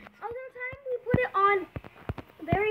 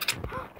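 surprised gasp; 5 semitones higher, 9 dB quieter than usual. Real, not acted. Finally escaping the chest.

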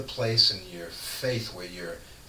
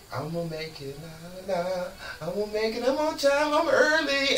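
mock breezy self-talk; 9 semitones higher than usual. Driver late to a meeting, breezy singsong reassurance. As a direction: hum it like a carefree driver who's running late.

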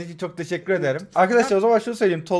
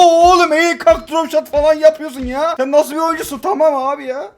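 high, loud hyped praise; excited; 8 semitones higher, 6 dB louder than usual. Real, not acted. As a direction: gush over the trick shot. Gushing over friend's trick shot.

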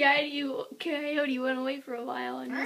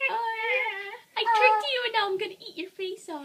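higher, gleeful trickster voice; taunt, then rush the threat; sneaky coyote; 7 semitones higher, faster than usual. Acted. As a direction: coyote gloating he faked death. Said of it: "He was like".

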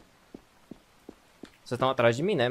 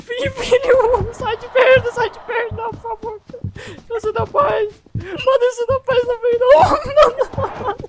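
scared horror game streamer; 11 dB louder than usual. Real, not acted. Chased by ugly monster, screaming.

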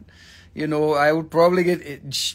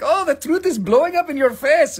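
high, loud gleeful taunt; triumphant mock voice; mock gleeful tweet; 11 semitones higher, 6 dB louder than usual. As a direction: gloat like a Twitter poster. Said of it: "It is like".

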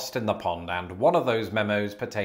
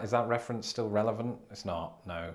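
normal talking voice

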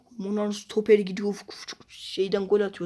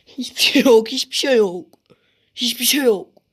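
frustrated yell; 4 semitones higher, 8 dB louder than usual. Real, not acted. Yelling in frustration at the game.

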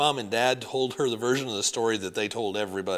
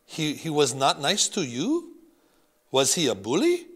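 put-on innocent French accent; 3 semitones higher, 5 dB louder than usual. Grandmother innocently questioning grandson, French accent. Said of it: "Grandmere had a thick French accent".

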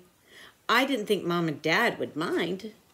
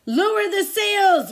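high, loud, slow storm order; captain's shout; 12 semitones higher, 6 dB louder than usual. A narrator's reading. Captain orders crew in the storm: loud shout. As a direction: bellow over the storm. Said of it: "Braidbeard shouted".

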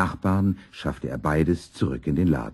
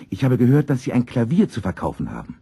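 higher, louder and quick, all eagerness; excited student; 8 semitones higher, 4 dB louder, faster than usual. A narrator's reading. Music student rushing in to ask shopkeeper about a piano, excited. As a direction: blurt it out like an excited young customer. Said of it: "ganz aufgeregt hereingelaufen kam".

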